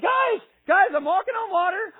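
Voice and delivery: very high, loud shout to friends; hammed-up giddy boasting; thrilled calling out. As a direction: holler for everyone to look. Peter calling to the disciples.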